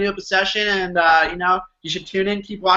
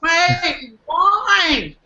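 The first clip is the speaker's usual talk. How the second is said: high mock surprise; 10 semitones higher than usual. Playful mock confusion.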